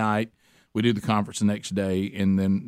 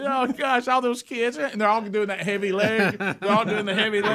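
amused mockery; 8 semitones higher than usual. Mocking tired families outside.

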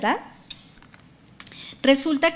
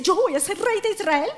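amazed David; 7 semitones higher than usual. Acted.

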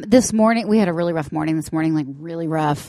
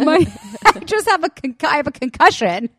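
put-on higher, pitiful little boy; a hint of a dazed child; lightly voiced kid; 8 semitones higher, 3 dB louder than usual.

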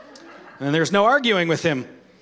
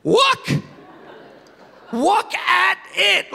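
put-on upset toddler; 12 semitones higher, much slower than usual. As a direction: cry it out like an alarmed two-year-old. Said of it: "he starts saying".

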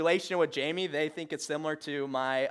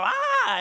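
a sudden high yelled "Ah"; loud "Ah"; 6 dB louder than usual. Retold or imitated. Dad in the car yelling "Ah" to get his son copying. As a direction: belt out a high "Ah" to set the kid off. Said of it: "and I go".

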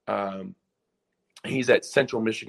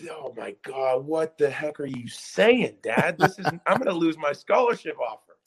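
higher, exasperated plea to dad; retold mortified panic; 5 semitones higher than usual. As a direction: play up the horrified embarrassment. Retells embarrassment at dad's remark.

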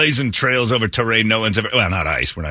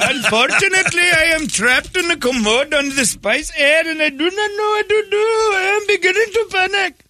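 put-on much higher, squeaky Indian accent for the trapped man; mock Indian accent. Trapped passenger to crew, much higher mock Indian accent. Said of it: "in that voice".

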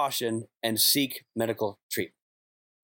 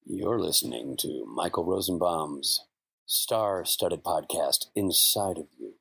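smooth late-night radio DJ; 7 semitones lower than usual. Shown as a demonstration. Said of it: "give me a little D J voice".

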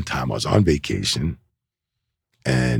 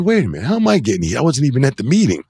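high-pitched, retold objection; acting out baffled indignation; indignant protest; 11 semitones higher, 4 dB louder, faster than usual. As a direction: plead your innocence as if replaying it. Retelling his protest at getting heat.

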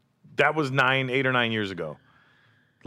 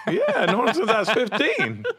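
laughing while talking; 12 semitones higher, 5 dB louder than usual. Retold or imitated. Retelling his comeback to Tyson.

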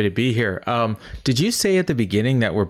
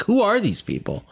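high, stunned question; baffled disbelief; 11 semitones higher than usual. Retold or imitated. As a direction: sound utterly bewildered. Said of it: "I'm looking around like".

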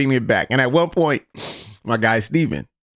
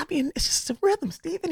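soft advice; 7 dB quieter than usual. Retold or imitated. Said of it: "I said".

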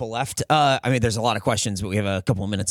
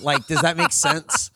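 laughing; 13 semitones higher, slower than usual. Real, not acted. Laughing at own embarrassment.

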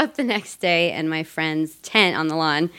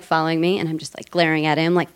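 ordinary talk

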